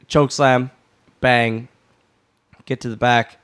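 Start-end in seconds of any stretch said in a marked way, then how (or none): none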